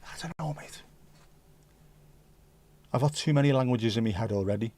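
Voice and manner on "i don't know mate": unvoiced whisper, breath only. Real, not acted. Whispering an aside to the chat.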